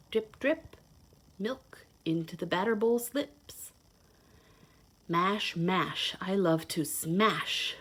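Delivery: lively storybook read-aloud; reading to children. Acted. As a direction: read it like a picture book to little kids. Reads picture book to children.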